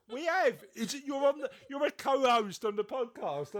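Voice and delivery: high, playful indignation; mock exasperation. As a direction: scold your co-host in mock disbelief. Mock-scolding co-host for commenting.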